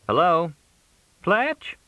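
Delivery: high-pitched tentative caller; high questioning character voice; Crystal on the phone. A narrator's reading. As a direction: pitch it high, checking someone is there. Late-night phone call, Crystal voiced.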